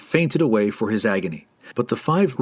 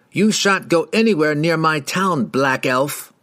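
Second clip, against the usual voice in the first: higher, mildly raised cry; indignant cry; 6 semitones higher than usual. A narrator's reading. Rider forbids the dark elf his town, crying out. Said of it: "another rider cried".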